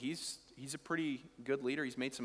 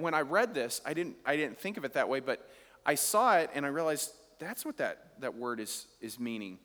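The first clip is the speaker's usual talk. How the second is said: ordinary delivery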